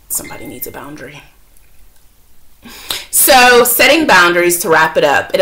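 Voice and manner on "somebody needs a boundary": very soft, quick grumble under the breath; barely voiced, hushed and fast; muttered aside. Real, not acted. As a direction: mutter it to yourself after a sigh. Aside about caller interrupting the video.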